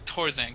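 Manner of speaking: "Torzane" with a hard T in place of TH; Korean-style T for TH. Shown as a demonstration. Caster explaining the announcer's accent, "Torzane" with a plain T. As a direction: say "Torzane", no th sound at the start. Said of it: "they don't have a th sound".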